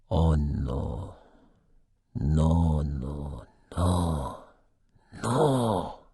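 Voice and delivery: soft broken no's, fading to a cry; a man hearing his partner is leaving, voice breaking; despairing no. A narrator's reading. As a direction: let each no crumble as it sinks in.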